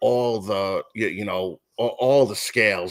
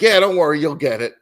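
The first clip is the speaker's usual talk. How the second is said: breezy reassurance; 6 semitones higher, 7 dB louder, faster than usual. Retold or imitated. Voicing the preacher bandleader.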